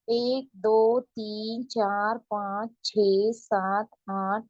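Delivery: slow, drawn-out review count; teacher's slow review count; slow counting aloud. Shown as a demonstration. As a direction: count it through slowly for new learners. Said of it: "एक बार हम पढ़ लेते हैं पूरा".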